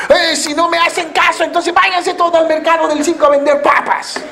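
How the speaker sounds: high-pitched, hectoring boss voice; bossy tyrant. Acted. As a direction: order them off like a petty tyrant. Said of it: "como un mandón".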